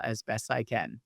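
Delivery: usual speaking voice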